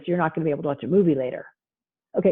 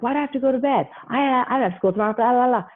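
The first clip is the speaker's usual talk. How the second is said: put-on whiny kid; 7 semitones higher, faster than usual. Child arguing with parent about bedtime, high whine. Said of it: "And you're like".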